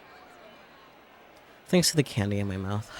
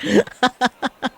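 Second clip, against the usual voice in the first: sudden high-pitched laugh; high burst of laughter; 10 dB louder than usual. Real, not acted. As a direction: squeal with laughter. Laughs at Devil Strongest joke.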